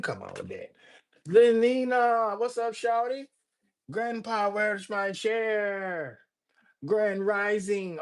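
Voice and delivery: ordinary talk, nothing marked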